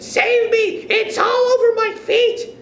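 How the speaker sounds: high-pitched panicked cries; screaming in panic. Acted. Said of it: "screamed Missus Twit".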